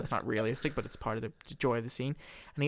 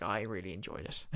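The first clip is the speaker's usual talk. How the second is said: much lower and softer, relishing; gloating; 5 semitones lower, 8 dB quieter than usual. Retold or imitated. Coleman gloating to his brother, low and soft. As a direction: savour it quietly and cruelly.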